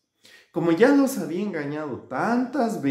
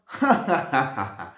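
rapid acted ha-ha-ha; jeering staccato cackle; mocking laugh. A narrator's reading. Shepherd boy jeering at his friends, acted laugh. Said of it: "se burlaba de ellos".

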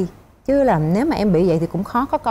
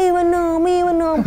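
nagging husband; 8 semitones higher than usual. Retold or imitated. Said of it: "Mà ổng cứ".

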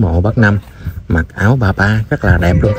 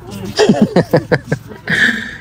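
high breathy laugh; 10 semitones higher, 4 dB quieter than usual. Real, not acted. Laughing at being fellow YouTubers.

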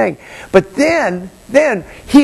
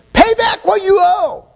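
high, harsh demand; demanding threat; 10 semitones higher than usual. Acted. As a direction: choke the words out at him. Slave choking his fellow.

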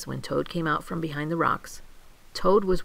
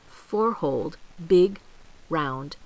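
plain reading voice

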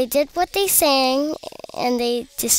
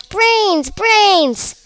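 two high, loud yells; shouting; 9 semitones higher, 9 dB louder than usual. A narrator's reading. Gargantula at the computer craving brains, shouting. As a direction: yell each word high and full. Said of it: "shouted the Gargantula".

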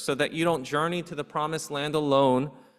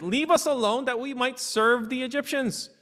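high, biting sarcastic whine; sarcastic complaint; 7 semitones higher than usual. Retold or imitated. Israelites mocking Moses in a high sarcastic whine. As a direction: sneer it like a bitter joke. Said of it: "it's some sarcasm".

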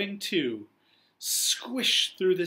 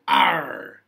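pirate arr; 4 semitones lower, 8 dB louder than usual. Acted. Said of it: "Pete muttered an".

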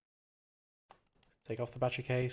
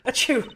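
loud fake sneeze; 10 semitones higher, 7 dB louder than usual. Mimics the sneeze.

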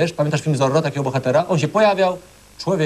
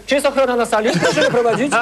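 laughing, higher; mock call for security through laughter; 7 semitones higher than usual. Real, not acted. Judge laughing, calls security. (ze śmiechem)

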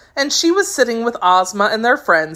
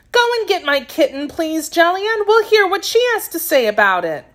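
put-on high-pitched girl's voice, pleading; a child's high voice, asking earnestly; earnest little girl; 11 semitones higher than usual. Girl sending the maid for her kitten, earnest.

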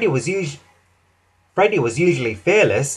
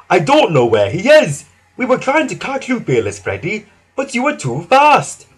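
high, distressed explanation; worried little engine in a fluster; crying out; 6 semitones higher, 4 dB louder than usual. A narrator's reading. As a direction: blurt it out upset, voice pitched up. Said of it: "cried Skarloey".

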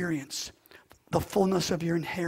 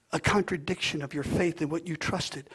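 ordinary talk, nothing marked

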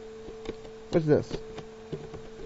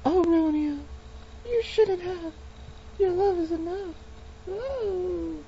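put-on high swooning girly voice, much higher; 12 semitones higher, 4 dB quieter than usual. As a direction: swoon like a lovestruck girl. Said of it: "my groggy voice is ruining the".

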